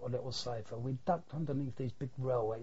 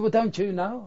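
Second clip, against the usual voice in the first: indignant question; 7 semitones higher, 8 dB louder than usual. Retold or imitated.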